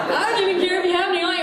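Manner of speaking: high, loud, building mock ad; hammed-up ad read. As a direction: escalate the absurd list. Escalating mock ad.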